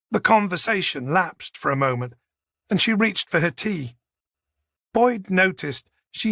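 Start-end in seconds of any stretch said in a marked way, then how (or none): none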